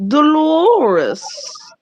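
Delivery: high, loud, stretched-out Dolores; Dolores drawn out theatrically. Shown as a demonstration. Announcing a legendary sister, Dolores stretched grandly. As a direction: sing out Dolores on a long middle vowel. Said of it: "I'll always say her name like that".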